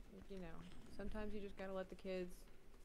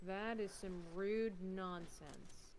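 slow drawn-out; much slower than usual. Pretend. Slow mock complaint.

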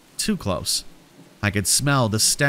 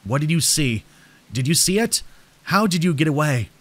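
higher, urgent questions building; increasingly frantic; 4 semitones higher than usual. A narrator's reading. Stranger interrogating the person he grabbed, growing frantic. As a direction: fire off questions with rising urgency. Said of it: "his voice growing more and more frantic".

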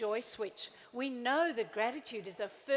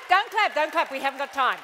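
much louder, cutting off clapping; loud over applause; 13 dB louder than usual. Real, not acted. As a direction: wave off the applause loudly. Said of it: "audience applauding".

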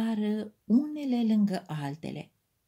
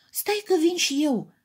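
high mild call; 8 semitones higher, 4 dB louder than usual. A narrator's reading. Crayon box calls to the others to wait, a mild call. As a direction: call after them, not quite a shout. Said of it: "striga atunci cutia".

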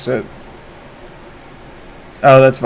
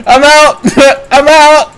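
high words through laughter; laughing hard; 10 semitones higher, 4 dB louder than usual. Real, not acted. Streamer escaping in the game, laughing hard. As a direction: crack up, squeak the words out.

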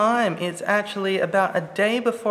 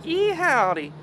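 a quick hammed-up cowboy "Yeah, howdy"; jokey American cowboy accent; mock American drawl; 9 semitones higher than usual. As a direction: toss off a jokey Texan cowboy greeting, pitched up. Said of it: "Not everyone in America's like".

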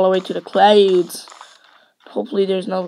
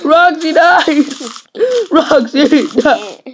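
loud, drawn-out mock lament; mock wailing; 5 semitones higher, 9 dB louder, slower than usual. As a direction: wail it in pretend grief, breaking into laughter. Mock mourning of dead Roxy.

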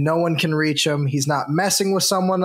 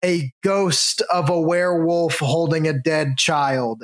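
ordinary delivery

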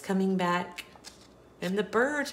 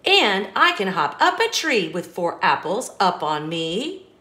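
put-on bouncy storybook reading for a child; lively read-aloud; 8 dB louder than usual. Parent reading an apple-stacking book to a child, sing-song. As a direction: read it like a bedtime picture book, rhythmic and bright.

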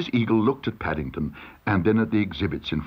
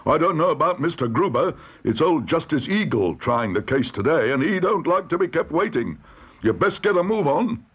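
higher-pitched usher hurrying someone along; brisk court usher; 6 semitones higher than usual. A narrator's reading. Usher hurries Paddington to Justice Eagle's court, officious.

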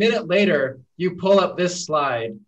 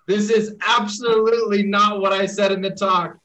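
higher-pitched, firm quoted denial; emphatic retold rebuke; 6 semitones higher, 4 dB louder than usual. Reading the speaker's reply to a wrong tweet, emphatic. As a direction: lean hard on the denial, quoting someone else.

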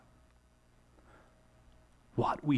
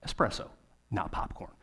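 1 whispered punchline; 4 semitones lower, 5 dB quieter than usual. Real, not acted. Punchline after espresso example.

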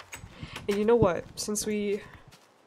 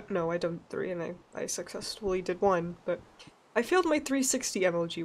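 ordinary talk

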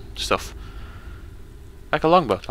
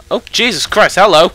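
loud, high jolt of shock; shocked shout, loudest on hello; startled yell; 9 semitones higher, 10 dB louder than usual. Real, not acted. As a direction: yelp it out as if jumped. Startled by an enemy appearing.

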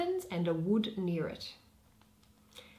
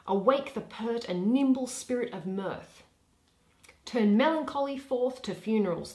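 lightly voiced duke giving orders; commanding duke; 4 semitones higher, 8 dB louder than usual. Duke Theseus ordering Philostrate to stir up merriment, lightly commanding. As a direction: rouse the court like a ruler.

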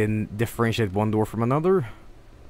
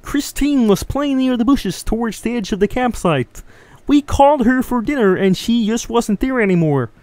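high froggy puppet voice all the way through; Kermit voice; 9 semitones higher than usual. Pretend. Streamer reading a missing-girl note as Kermit. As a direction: read it like Kermit the Frog. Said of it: "I don't know why I'm doing Kermit".

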